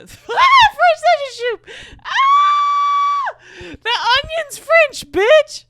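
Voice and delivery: very high, full-force comic shriek; screaming outburst. Pretend. Reader bursting out about French soup, shrieking. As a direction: scream it out, stretched and piercing.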